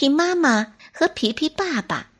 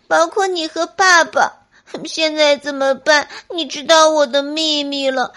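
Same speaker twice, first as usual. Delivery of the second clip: 高声哭腔诉说; 哭着向妈妈坦白; 边哭边说; 4 semitones higher, 4 dB louder than usual. Acted. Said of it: "边哭边跟妈妈说". (边哭边说)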